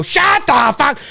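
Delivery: half-held mock shout; mild comic yell; mock outburst. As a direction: act out snapping at a noisy family. Acting out an outburst in cinema.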